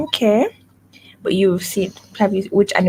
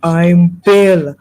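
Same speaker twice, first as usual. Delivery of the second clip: bullying bull with punched opening words; deep voice; 2 semitones lower, 4 dB louder than usual. Acted. Tutor shows pupil the bull's boast, deep voice. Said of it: "he said it in a deep voice".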